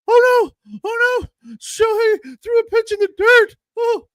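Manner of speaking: put-on high, panicky little old lady voice; Dave Roberts as little old lady. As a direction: squeal it like a frightened granny. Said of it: "I made Dave sound like a little old lady".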